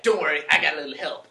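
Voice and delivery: Tony. Acted. Co-host voicing Tony in a predicted trailer, loud mock reassurance. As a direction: brush off the warning, loudly. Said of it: "he's gonna be like".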